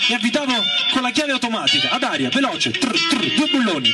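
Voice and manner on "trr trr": quick rattling "trr, trr" of an air wrench; imitated wrench noise. Worker mimes the automatic air wrench at work, imitated whirr. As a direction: mimic the pneumatic wrench in two short bursts.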